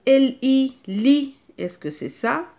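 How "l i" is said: L I spelled loud and higher; spelled-out syllable. Shown as a demonstration. Teacher spells letters for children, loud and high. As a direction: call out each letter clearly. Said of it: "je vais faire le premier exemple avec vous".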